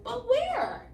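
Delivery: high anxious goat voice; worried middle goat. Acted. Middle goat asking his brothers, high and anxious. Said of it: "asked the middle brother".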